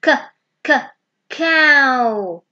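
k, k, cow with the k sound tapped out twice, then cow said loudly; teacher sounding out cow for kids to copy. Shown as a demonstration. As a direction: break the word cow into k, k, cow for children.

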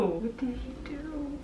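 high, soft, slow disbelief; hushed shock. Real, not acted. Reader aghast at what the character did, hushed. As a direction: repeat it in a stunned hush.

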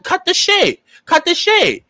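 high, forceful order, said twice; telling viewers to quit the excuses, pitched high; emphatic demand. Real, not acted. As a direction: slam it down hard. Ranting at viewers to stop.

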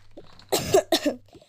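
loud run of coughs; sick cough. Pretend. Sick patient while doctor explains pills, coughing. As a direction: cough repeatedly and loudly.

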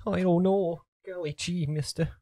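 lightly voiced gee-whiz kid; aw-shucks old-timey kid; wholesome 1950s kid. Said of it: "Oh, golly".

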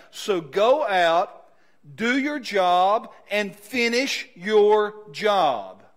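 slow, loud, punched words; rally the team to go finish it; rising coach's charge. Retold or imitated. Preacher voicing the coach to his team, slow and loud.

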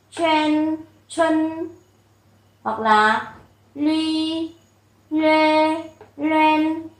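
crisp model syllables, each one separated; teacher models the syllables for students to repeat; read-along drill. Shown as a demonstration. Giáo viên đọc mẫu từng âm rõ ràng. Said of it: "chúng ta sẽ đọc như thường".

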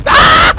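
sudden high shriek of fright; startled scream. Real, not acted. Gamer shrieking at a sudden monster. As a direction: scream at the jump scare. Horror game jump scare.

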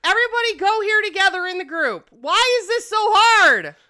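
calling out, fed up and pleading; exasperated shout. Real, not acted. Streamer yelling at sims to group up, exasperated shout.

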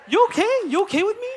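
high-pitched mock-concerned questions; acted check-in. Pastor confronting a gossiper, high questioning voice. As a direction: pitch it right up, checking on someone.

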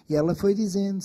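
usual speaking voice

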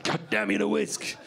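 low, strained mock fury. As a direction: curse a kitchen tool under your breath. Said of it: "[name] halfway through cooking".

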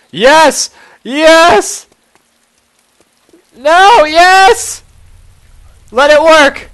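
high, very loud frantic yells; frantic high-pitched outbursts; desperate shouting. Real, not acted. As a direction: yell as if willing the car to start. Trying cars to escape, shouting.

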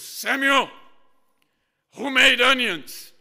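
loud, slow, booming general's voice; army dad questioning his son, loud and slow; stern army father. Retold or imitated. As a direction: bark it like a drill sergeant. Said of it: "he's kinda like a general".

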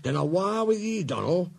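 high Scottish voice, giving orders; urgent Scottish driver. Acted. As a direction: lay out the plan firmly in Scots.

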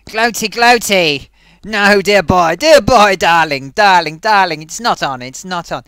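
put-on a pompous old thespian tut-tutting; luvvie actor voice. Thespian puppet scolds Gloaty, loud luvvie voice.

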